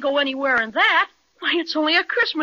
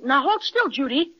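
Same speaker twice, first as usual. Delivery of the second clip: ordinary talk, nothing marked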